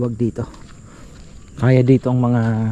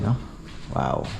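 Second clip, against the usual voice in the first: usual speaking voice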